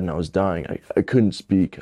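ordinary delivery

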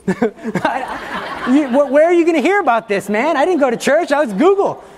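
high, loud mock-defensive protest; exasperated joking outburst, pitch jumping up; comic indignation. Real, not acted. Speaker defending his internet search to listeners, mock indignant.

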